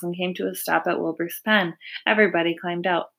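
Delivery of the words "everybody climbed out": ordinary delivery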